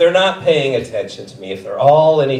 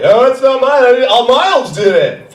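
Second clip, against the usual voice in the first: put-on high, louder child whine; whiny kid excuse; 9 semitones higher, 6 dB louder than usual. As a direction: blame someone else like a caught kid. Kid blames classmate.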